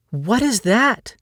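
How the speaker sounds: high, slow, thrilled question; delighted. Acted. Jillian seeing the sap ooze from the tree, delighted and excited. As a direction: burst out in wonder and delight. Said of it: "her voice filled with delight".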